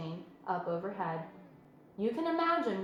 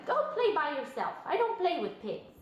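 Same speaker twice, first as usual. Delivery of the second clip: dismissive lamb; 6 semitones higher than usual. A narrator's reading. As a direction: wave the pig away.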